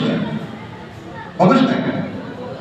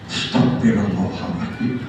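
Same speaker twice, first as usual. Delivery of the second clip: soft sigh of dismay; 6 dB quieter than usual. Acted. Preacher sighs at sinful gossip.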